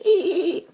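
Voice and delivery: loud, very high dolphin squeal; dolphin laughing. Retold or imitated. Dolphin laughing at a frightened boy, squealed imitation. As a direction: imitate a dolphin's shrill cackle. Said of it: "the thing's laughing at you".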